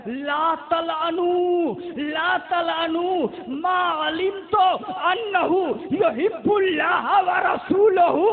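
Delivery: loud Arabic proclamation with drawn-out words; proclaimed hadith. Retold or imitated. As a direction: build to a ringing shout as you quote. (अरबी हदीस बुलंद आवाज़ में, खींचकर)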